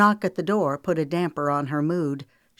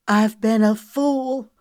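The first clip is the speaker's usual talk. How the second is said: tearful; 6 semitones higher, 7 dB louder, slower than usual. Acted. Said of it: "Tears were welling in her eyes".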